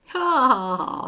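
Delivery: loud, high "Ha, ha, ha"; hearty laugh. A narrator's reading. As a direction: burst out laughing along. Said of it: "joining in".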